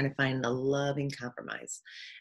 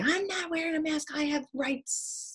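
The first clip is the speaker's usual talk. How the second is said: mock defiance; 11 semitones higher than usual. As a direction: play the stubborn rights defender. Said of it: "fight with my sister and say".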